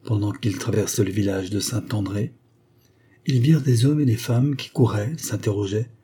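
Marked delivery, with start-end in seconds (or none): none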